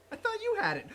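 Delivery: high, easy Goku voice; casual cheerful Goku line; Goku impression. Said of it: "Goku would actually have confidence in him".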